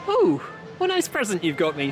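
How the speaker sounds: ordinary delivery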